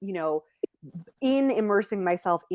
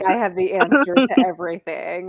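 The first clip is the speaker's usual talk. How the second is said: louder, sarcastic mock claim; mock sarcasm; 5 dB louder than usual. A friend mocking the idea that a traveller knows all, sarcastic and loud. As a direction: say it with a sarcastic sneer. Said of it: "Right, like".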